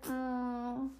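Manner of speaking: quiet mock agreement to husband; soft sarcastic reply. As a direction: murmur it with playful irony. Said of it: "I was like".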